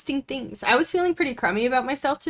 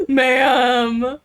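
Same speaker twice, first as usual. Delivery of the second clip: shocked gasp; 6 dB louder than usual. Real, not acted. Shocked at the paladin.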